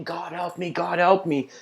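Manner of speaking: high-pitched, mildly acted cry for help; shouted plea. Slave tied to the horse calls on God, mock shouting. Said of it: "the slave is shouting".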